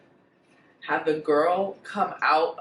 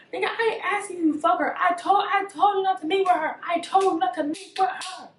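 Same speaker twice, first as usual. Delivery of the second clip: angry rant; 11 semitones higher, 5 dB louder than usual. Retold or imitated.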